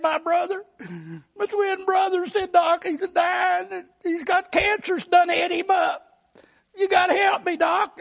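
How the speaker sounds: high, pleading hillbilly voice; frantic mountain man. Retold or imitated. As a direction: beg the doc in a panic. Ezra begging the doctor.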